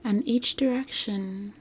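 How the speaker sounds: measured, soothing guide with long pauses; guided meditation. Acted. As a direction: gently lead the listener into relaxation, phrase by phrase.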